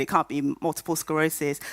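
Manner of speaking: usual speaking voice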